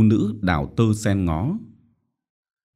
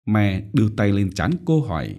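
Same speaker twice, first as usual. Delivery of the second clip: usual speaking voice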